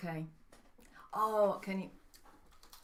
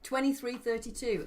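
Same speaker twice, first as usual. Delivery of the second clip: My Fair Lady London accent; Cockney-tinged London accent; 3 semitones higher than usual. Real, not acted. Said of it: "[name] just went full My Fair Lady there".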